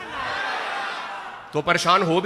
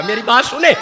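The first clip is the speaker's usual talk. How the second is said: loud, fast exhortation that builds; impassioned call to the audience; rousing preaching; 5 semitones higher, 5 dB louder than usual. Acted. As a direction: whip up the crowd to shout. Preaching, urging the crowd.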